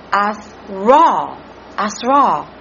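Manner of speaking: "asra" with the accent on a long "raw"; "asra" said carefully, second syllable stressed. Shown as a demonstration. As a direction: sound out "asra", leaning on the final "ra". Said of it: "with the accent on the R A W".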